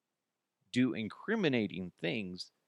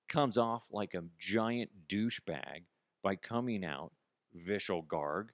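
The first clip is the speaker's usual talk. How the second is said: ordinary talk, nothing marked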